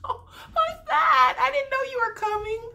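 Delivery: very high, gushing mock surprise; imitating fake laugh and delight. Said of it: "Becca does the fake laugh, like".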